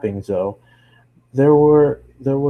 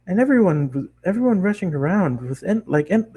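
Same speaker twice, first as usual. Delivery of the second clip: higher bubbly chatter; excited pony girl; 8 semitones higher than usual. Acted. Bakery girl gushing to the traveler, high and bubbly. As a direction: keep the giddy pony voice going.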